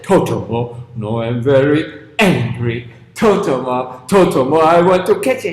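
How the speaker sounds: low, loud growling giant; angry giant. Acted. Giant climbing out of water, furious low shout. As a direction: bellow it low with rage.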